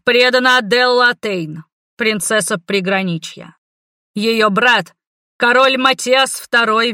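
town crier. Acted. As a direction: announce to the whole square. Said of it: "Голос глашатая поплыл над площадью".

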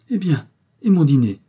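exclamation aiguë et forte de madame Minot; elle s'écrie, bras levés au ciel, plus haut et plus fort. Acted. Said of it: "madame Minot s'écria". (la voisine s'exclame soudain pour son dîner, aiguë et forte)

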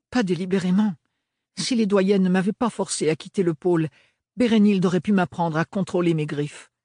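ordinary delivery

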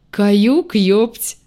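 cheerful booming echo voice; echoing ethereal energy being. A narrator's reading. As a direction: announce it brightly, echoing. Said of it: "жизнерадостно оповестил ... гулкий голос".

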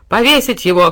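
loud cry for hanging; shout. A narrator's reading. Ivy shouting for execution. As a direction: shout the sentence. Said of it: "крикнул Плющ".

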